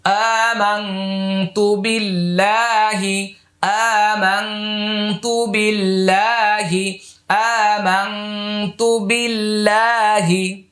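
slow, drawn-out recitation of আমানতু বিল্লাহি. Shown as a demonstration. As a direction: stretch each syllable like a Quran teacher modelling. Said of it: "এইভাবে শিখে যাবেন".

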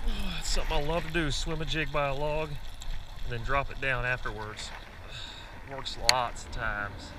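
loud, delighted burst; excited. Real, not acted. Excited about a fish bite.

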